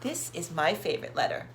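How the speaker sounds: usual speaking voice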